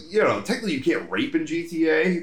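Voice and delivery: ordinary delivery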